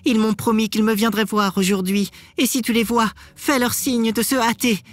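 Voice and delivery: high, anxious wife's voice; wife urging her sister. A narrator's reading. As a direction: plead high-pitched with your sister. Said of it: "elle appela sa sœur et lui dit".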